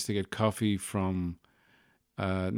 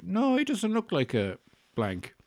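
much higher, lilting broadcaster impression; Terry Wogan; 11 semitones higher than usual. Dad-to-be rethinking his son's name, in a Terry Wogan voice. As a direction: go right up high like an old-time presenter. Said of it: "I sound like ... Terry Wogan there".

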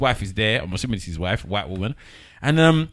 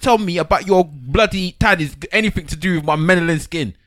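hammed-up indignant rant, loud and high; mock outrage; 6 semitones higher, 4 dB louder than usual.

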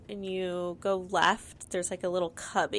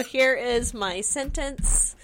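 slow, raised, word-by-word proclamation; mock declaration; 4 semitones higher, 4 dB louder, much slower than usual. Stoner presenting his random words as a sentence, mock declaration. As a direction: present it grandly, stretching each word.

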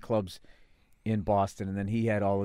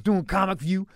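imitating the host; 8 semitones higher, 5 dB louder than usual. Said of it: "he goes".

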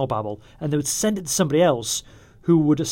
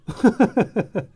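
high, rapid run of chuckles; real high-pitched laugh; amused chuckle; 7 semitones higher, 4 dB louder than usual. Real, not acted. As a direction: break into a quick giggle. Laughs at his vague scene summary.

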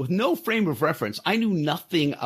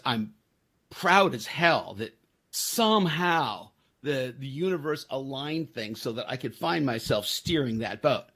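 normal talking voice

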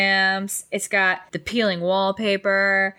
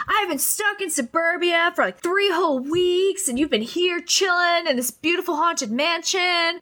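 high, whiny mock complaint; pitched-up petulant imitation; bratty whine; 10 semitones higher than usual. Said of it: "He's like".

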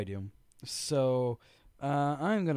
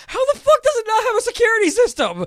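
high, very loud incredulous yell; angry rant; 14 dB louder than usual. Real, not acted. Podcaster yells about a house without an alarm, angry rant. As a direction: explode in outraged disbelief. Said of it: "That made me so angry".

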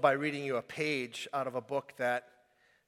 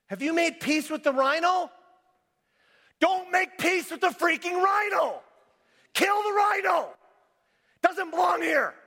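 much higher, much louder full shout; yelling at the congregation; 9 dB louder than usual. Acted. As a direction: yell it at them like a wake-up call. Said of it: "So I yelled that at you".

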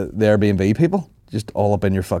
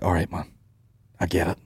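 resigned acknowledgement; 3 semitones lower, 8 dB quieter than usual. Acted. Placating the lovely but rambling host.

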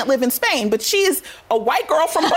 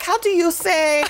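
mock Hilaria fumbling for a word; 4 semitones higher, slower than usual. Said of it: "She's like".

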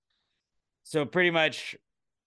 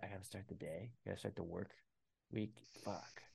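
dreading Sunday voice; 4 semitones lower, 18 dB quieter than usual. Retold or imitated.

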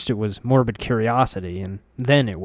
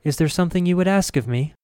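higher, quicker healer voice; lightly voiced old woman; 8 semitones higher, faster than usual. A narrator's reading. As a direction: play the gentle healer lightly. Grace's voice.

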